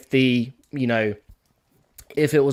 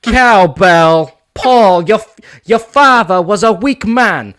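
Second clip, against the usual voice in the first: high, loud, slow halting voice; Christopher Walken impression; 7 semitones higher, 9 dB louder, much slower than usual. Brit asked for a New York accent does a Walken voice. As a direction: drag it out, pausing oddly. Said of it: "it's just gonna be Christopher Walken".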